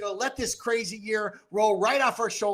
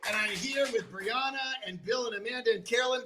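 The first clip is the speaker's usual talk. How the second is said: ordinary talk, nothing marked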